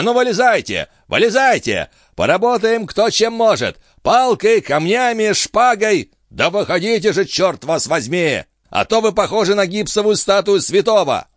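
high-pitched impatient shouted commands; bellowing. A narrator's reading. Jean orders Gilbert out to fight, bellowing. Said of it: "во всю силу лёгких рявкнул Жан".

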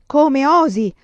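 loud, high rebuke; outraged. A narrator's reading. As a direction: scold with an elder's outrage. Il patriarca rimprovera. (rimprovero indignato)

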